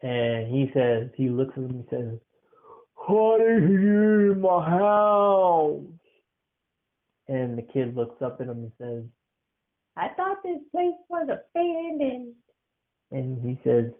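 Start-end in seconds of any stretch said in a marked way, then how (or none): 2.9-5.8 slow, louder, looming voice; a tall stranger demanding answers; slow heavy menace; stern old man. Acted. Tall old man confronts kid. 10.0-12.3 put-on high small kid voice; a child caught out; high, meek childlike reply; timid little boy. Kid answers stranger.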